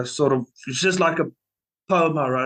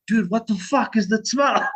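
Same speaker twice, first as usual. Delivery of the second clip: high-pitched imitation of an appalled bandmate; mock incredulous; 8 semitones higher than usual. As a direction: act out their baffled complaint, pitched up. Band reacting to stench on stage.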